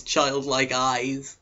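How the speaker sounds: plain reading voice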